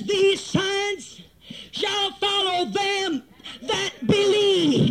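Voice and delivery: slow, weighty stress through "them that believe"; emphatic scripture quotation. Real, not acted. Preacher correcting the misquoted verse, emphatic slow "them that believe". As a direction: stretch out "them that believe", each word hit separately.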